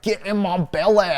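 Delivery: high, loud, slow Scottish brute voice with a laugh; Fat Bastard impression. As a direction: roar it like the Austin Powers glutton. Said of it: "Fat, um, he's like".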